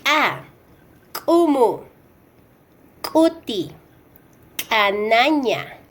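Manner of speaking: "ka", "Kumu", "Kuti", "Kanaña" with a popped glottal K; "ka", "Kumu", "Kuti", "Kanaña" as k'a, k'umu, k'uti, k'anaña; ejective K. Shown as a demonstration. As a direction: pop the K of "ka", "Kumu", "Kuti", "Kanaña", then the vowel. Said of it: "Como último de este grupo tenemos".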